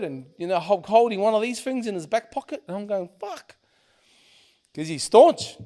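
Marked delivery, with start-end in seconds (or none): 3.2-3.6 high, soft swear; small high-pitched exclamation of dismay; dismayed retold curse. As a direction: re-enact quiet alarm. Retelling dismay at armed friends.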